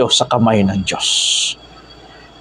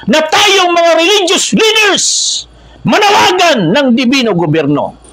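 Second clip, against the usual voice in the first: high, insistent exhortation; impassioned preaching; 12 semitones higher than usual. Real, not acted. Preacher urges religious leaders to call out, impassioned preaching. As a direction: drive it home with voice pitched high.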